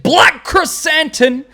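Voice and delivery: rolled announcer name. Pretend. Announcing the character.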